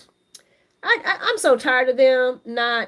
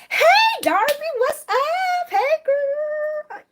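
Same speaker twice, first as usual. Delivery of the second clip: squealing greeting; slower than usual. Real, not acted. Greeting viewer Darby on stream in a high girly squeal. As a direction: squeal hello like a best friend. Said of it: "I called you a girl. I'm sorry".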